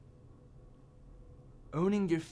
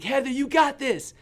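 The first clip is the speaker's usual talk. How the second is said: high, loud shout up from below; re-enacted cheering yell, pitched way up; yelled encouragement; 11 semitones higher, 9 dB louder than usual. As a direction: yell to cheer on a scared friend far off. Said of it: "I'm yelling".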